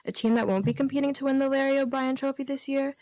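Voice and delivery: usual speaking voice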